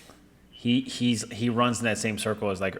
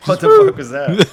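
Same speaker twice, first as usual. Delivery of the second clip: very high, loud shocked question; mock startled outburst; 11 dB louder than usual. Man in bed hearing a thud, mock startled. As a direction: yelp it in comic alarm. Startled by noise in retold scene.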